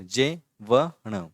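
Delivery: जे व ण, slow, one syllable at a time. Shown as a demonstration. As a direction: sound out each syllable slowly. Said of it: "तो ये देखो".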